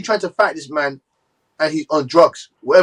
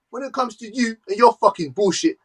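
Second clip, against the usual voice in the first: normal talking voice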